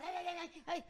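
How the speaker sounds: mock yapping of a little dog; imitated dog yapping. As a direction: yap like a small frantic dog. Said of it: "esco-, la perrita".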